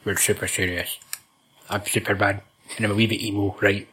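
low, soft mock-serious superhero voice; mock earnest Superman. Mocking Superman to his mate, in a low, soft, earnest voice. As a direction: play it dead serious and brooding. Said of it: "coming out really earnest".

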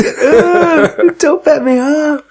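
high, slow, squeaky mock quote. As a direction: squeak it slowly, hammed up. Quoting film line, high.

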